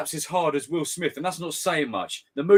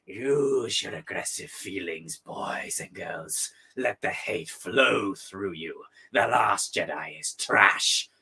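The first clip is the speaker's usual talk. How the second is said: lower, slow sinister villain voice; slow and menacing, dropping to a breath on the second line; villain impression; 8 semitones lower, much slower than usual. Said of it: "The way you said flow was spot on".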